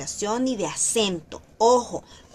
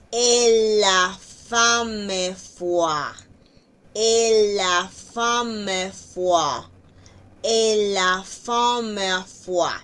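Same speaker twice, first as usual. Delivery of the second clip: "Et la femme foi" stretched very slowly, louder; very slow demo; 4 dB louder, much slower than usual. Shown as a demonstration. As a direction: drill it slowly for the class. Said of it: "Aquí hay una aleación". ("Et la femme foi" muy lento)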